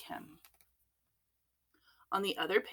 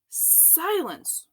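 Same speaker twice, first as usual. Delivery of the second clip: higher, sharp commanding roar; 12 semitones higher, 4 dB louder than usual. A narrator's reading. Police chief silencing Clementine, roared command. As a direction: cut everyone off with one word. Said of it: "roared the chief".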